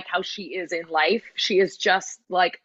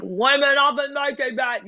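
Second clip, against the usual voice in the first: broad British accent, higher pitch; strong accent; 7 semitones higher, 3 dB louder than usual. Shown as a demonstration. Showing how lyrics can't be sung that strongly, overdone British accent. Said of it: "singing so strongly in the accent".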